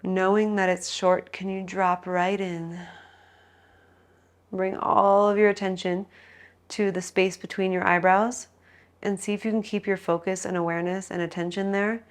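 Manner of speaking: soft, calm relaxation guidance; guided meditation. Acted. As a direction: ease them into stillness. Guides final Shavasana rest.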